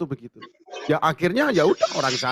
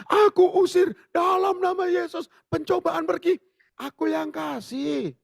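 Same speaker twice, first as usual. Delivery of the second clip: mock rebuke. Mock rebuke, then God's reply.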